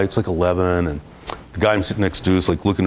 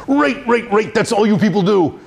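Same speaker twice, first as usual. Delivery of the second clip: much higher mock-furious outburst, repeating write; angry client imitation; 12 semitones higher than usual. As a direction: imitate the client losing his temper, pitched up high. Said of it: "like, really angry".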